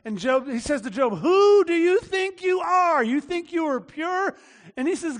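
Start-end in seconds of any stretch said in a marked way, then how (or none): 1.2-4.3 high, slow, loud indignant challenge; indignant accusation. Acted. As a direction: act out a righteous accuser drawing out every word. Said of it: "just indignant".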